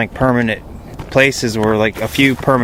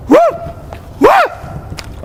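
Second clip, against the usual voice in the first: high rising whoop, called out; Bigfoot whoop call; 3 dB louder than usual. Shown as a demonstration. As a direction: throw a high whoop into the woods. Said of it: "they make whoops".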